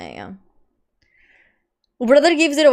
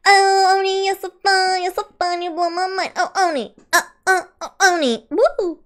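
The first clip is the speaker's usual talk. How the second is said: gushing fangirl; 8 semitones higher than usual. Acted. Gushing at a chatter.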